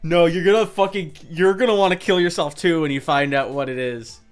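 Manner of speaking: ordinary talk, nothing marked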